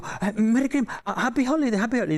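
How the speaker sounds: high, stumbling mock panic; acted flustered correction. Host correcting his Christmas wish to Spielberg, acted fluster.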